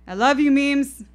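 loud, high call over the shoulder; yelling back. Acted. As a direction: yell it back while running upstairs. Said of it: "I yell back as I run up the stairs".

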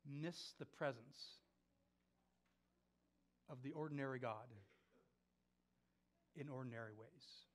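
soft, low, with long weighty pauses; solemn sermon appeal. Real, not acted. Preacher urges brothers and sisters to stay alert, solemn and hushed. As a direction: plead quietly and let every phrase hang.

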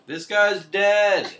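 dead drawn out slowly and loudly; loud slow announcement. Retold or imitated. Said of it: "Draco's mom ... is like".

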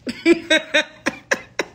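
real laugh at her own joke; laughing at the confused viewer; amused laugh. Real, not acted. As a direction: burst of high laughter. Laughing at Kathy.

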